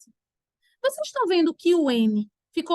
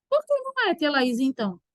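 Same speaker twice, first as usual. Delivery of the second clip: acted student query; imagined pupil. Voices a pupil's question to herself.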